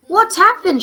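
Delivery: loud, high alarmed shout; shouting to a frantic woman up the road. Acted. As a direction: call out in alarm. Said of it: "shouted Baruti".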